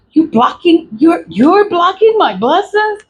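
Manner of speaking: high-pitched indignant question; incredulous challenge. Acted. Confronting someone blocking her blessings, incredulous. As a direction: challenge them in rising disbelief.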